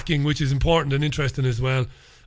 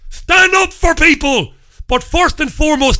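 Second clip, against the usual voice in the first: rallying shout; 10 semitones higher, 6 dB louder than usual. Real, not acted. Host rallies listeners to defend banned people, shouting. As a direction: bellow it like a rallying cry.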